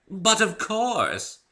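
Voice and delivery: high, very slow and delighted; delighted refined Rarity; enthusiastic. Acted. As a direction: savor it with relish. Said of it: "with a twinkle of enthusiasm".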